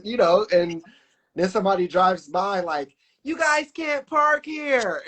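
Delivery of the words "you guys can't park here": high, slow taunting call; mocking troll. A passing driver taunting them at the crash, high and slow. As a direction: drag it out high like a passing prankster.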